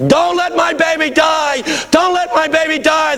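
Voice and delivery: high, drawn-out imitated scream; screaming plea. Mother banging on the plane as it leaves, screaming plea. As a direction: shout it like a desperate mother shut outside.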